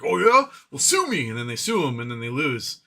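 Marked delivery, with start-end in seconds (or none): 0.0-1.3 high, loud mock defiance; defiant sue me. Elon's side daring lawyers, a loud cocky mock challenge. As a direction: dare them, cocky and loud. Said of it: "they just go to court like".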